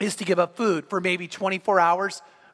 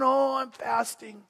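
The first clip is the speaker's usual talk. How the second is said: whiny faster. Pretend. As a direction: whine like a self-pitying faster, hammed-up. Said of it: "walk around going".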